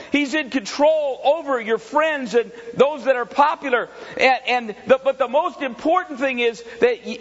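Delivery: high, loud, driving altar-call preaching; preaching at full force. Acted. Preacher pressing the congregation to yield, shouting. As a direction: press each question on the congregation.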